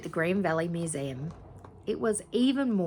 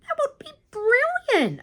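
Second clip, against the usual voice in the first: much higher, loud squealing voice; thrilled squeal; 8 dB louder than usual. A narrator's reading. Nelson thrilled the guard offers a photo, high squeal. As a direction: squeal with delight.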